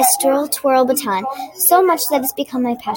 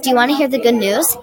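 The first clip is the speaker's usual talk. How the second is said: ordinary delivery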